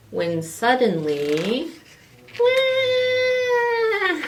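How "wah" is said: put-on high, piercing baby cry; baby wail. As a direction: wail like a newborn. Baby cries in story.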